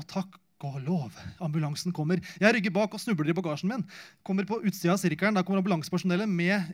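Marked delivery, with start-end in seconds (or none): none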